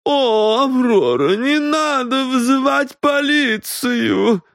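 very high, drawn-out woman's plea; plaintive protest. A narrator's reading. Companion begs Аврора not to call police, protesting. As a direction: protest in a slow, high wail. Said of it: "запротестовала та".

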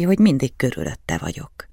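ordinary delivery

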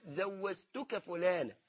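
slow زوزتك, z where the j should be; j pronounced as z. Shown as a demonstration. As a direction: show زوزتك with both consonants as z. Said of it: "بتنطق الجيم زاي". (نطق الجيم زايًا في زوزتك ببطء)

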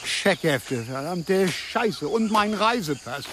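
low, slow muttered curse; cursing. Acted. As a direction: swear slowly in dismay. Discovers checkbooks and passport gone.

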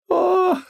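high-pitched sobbing gasp; a short, high whimpered "oh"; sob. A narrator's reading. Wife gasping at her monstrous husband, sobbing. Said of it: "всхлипнула она".

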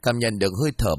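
ordinary delivery, nothing marked

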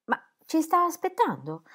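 a high, tentative question; doubtful bride. A narrator's reading. Ingeborg to her husband on arriving, doubtful.

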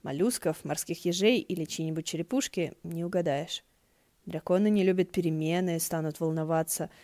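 ordinary delivery

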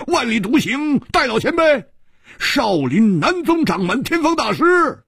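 high-pitched, straining list of names; hesitate, then proclaim each name high and emphatic; frantic bravado, grasping for hope; desperate self-reassurance. A narrator's reading. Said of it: "拼命地在安慰自己".

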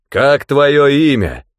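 slow, loud, thundering demand; a giant horseman booms it out; booming rider voice. A narrator's reading. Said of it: "прогремел голос всадника". (громовой голос всадника)

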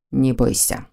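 soft low gentle growl; ласковый рык. A narrator's reading. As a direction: reassure her tenderly, low. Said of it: "ласково прорычала крылатая зверюга". (ласково прорычать)

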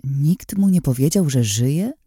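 usual speaking voice

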